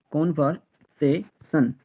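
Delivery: Conversation slowly split into kon-var-se-shon; syllable by syllable. Shown as a demonstration. Teacher drilling learners on the word, conversation said slowly in pieces. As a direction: break conversation into separate, slow syllables.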